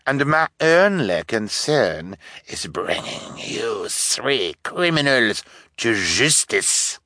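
slow, breath-heavy affected villain; hammed-up detective, hushed and stretched; pompous fake detective. A narrator's reading. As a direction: draw out every word with menace.

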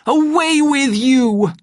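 high, loud, drawn-out roar; furious dismissal. A narrator's reading. Savage shoving Lenina away, slow enraged shout. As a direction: drive the listener away with a raging shout.

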